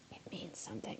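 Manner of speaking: soft whisper; hushed aside. Real, not acted. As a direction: drop to a whisper. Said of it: "whispered aside".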